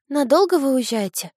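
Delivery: выше, голос девочки; сонный детский вопрос. A narrator's reading. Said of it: "спросила она". (голосом девочки)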